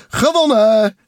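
very high, loud victory shout; proud cry. A narrator's reading. Takel finishing first, loud and proud. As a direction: crow the win at full height. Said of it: "zei Takel trots".

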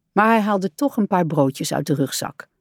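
usual speaking voice

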